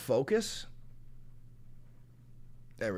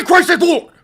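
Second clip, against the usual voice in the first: hammed-up Bible-thumper scream; mock zealot bellow; 13 semitones higher, 15 dB louder than usual. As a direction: roar it like a furious street preacher. Mocking religious commenters.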